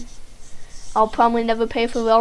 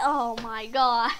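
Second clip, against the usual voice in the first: ordinary delivery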